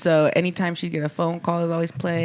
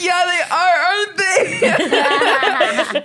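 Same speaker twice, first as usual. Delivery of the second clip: teary laugh; 12 semitones higher, 5 dB louder, much slower than usual. Retold or imitated. Crying at work, laughing.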